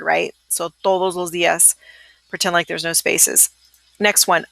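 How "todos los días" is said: "todos los días" blended into one word; run together. Shown as a demonstration. Teacher showing the right way, as one word. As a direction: glide it as if no spaces. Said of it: "you'll just say it as if it were one word".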